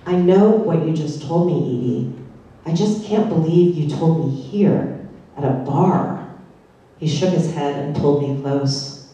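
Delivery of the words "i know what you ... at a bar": plain reading voice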